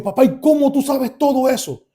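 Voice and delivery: high, loud astonished question; amazed question. Retold or imitated. Son asks father how he knows all that, amazed. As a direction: press him in amazement.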